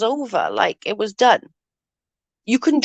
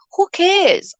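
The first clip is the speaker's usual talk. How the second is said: dismissive; 11 semitones higher than usual. Real, not acted. Dismissing the complaint.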